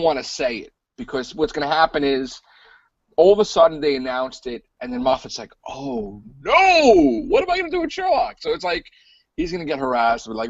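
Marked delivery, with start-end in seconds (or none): 5.7-8.4 high, hammed-up fretting; mock dismay. Host imitates showrunner losing his star, mock high-pitched dismay. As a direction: fake panic, pitch shooting up, rushing the question. Showrunner reacting to casting news.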